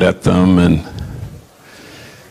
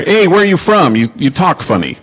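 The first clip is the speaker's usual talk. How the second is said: put-on cheeky kid who never filters, voice pitched up; David; 9 semitones higher than usual. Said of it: "So he, he says to her".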